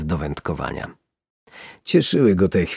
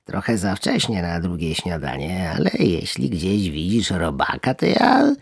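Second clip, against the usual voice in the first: greedy frog sneaking a snack; greedy little frog. Acted. Small frog eagerly hints she would eat a worm. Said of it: "spytała zachłannie druga żaba".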